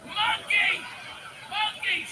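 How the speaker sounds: put-on very high, loud child's shriek; screaming child. Dad imitates his daughter on her chair, screaming child voice. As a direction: shriek it out like an excited little girl. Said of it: "She's screaming".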